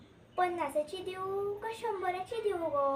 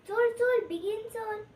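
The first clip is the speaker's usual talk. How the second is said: high, raised-voice call to hurry; urgent hurrying; 5 semitones higher, 5 dB louder than usual. Acted. Woman remembering rice left cooking, urgent hurrying call. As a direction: urge them on loudly, pitched up.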